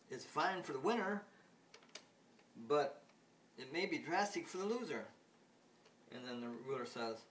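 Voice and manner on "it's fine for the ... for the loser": higher and louder, fretful; nervous Smith. Retold or imitated. Smith frets about the loser, high and loud. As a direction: warn with alarm. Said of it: "Smith says".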